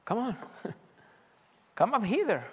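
a joyful, inviting summons; voicing the Lord. Preacher voices the Lord calling the believer up, laughing. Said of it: "o te pudiera decir".

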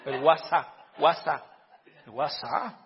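"WhatsApp" as "wa-SA", final p dropped; imitated "guasá" pronunciation. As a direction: playfully mimic "WhatsApp" as "wasá". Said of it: "dijo uno". ("WhatsApp" dicho "guasá", en broma)

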